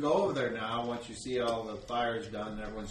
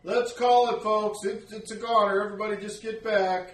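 high, louder villager giving up on the fire; calling out to crowd; 7 semitones higher, 6 dB louder than usual. Acted. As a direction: call the folks back.

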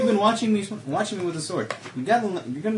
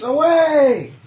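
battle cry; 7 semitones higher, 7 dB louder than usual. Acted.

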